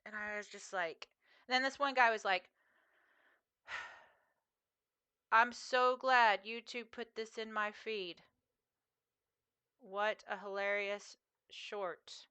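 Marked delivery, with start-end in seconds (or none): 3.7-12.3 very slow, measured mock praise; deadpan sarcasm. Streamer imitating a commenter on her short, slow and deadpan. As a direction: spell out every word, unimpressed. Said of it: "that was the tone".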